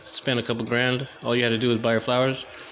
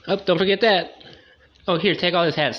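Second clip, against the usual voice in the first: high gossipy voice; 7 semitones higher, 4 dB louder than usual. Retold or imitated. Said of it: "chatty fire voices".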